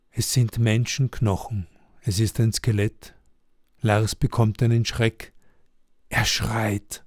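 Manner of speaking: usual speaking voice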